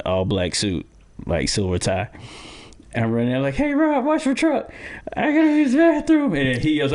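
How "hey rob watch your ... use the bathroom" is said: frantic call. Retold or imitated. Rushing past security, calling out to watch his truck, high and urgent. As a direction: yell it out while running for the door.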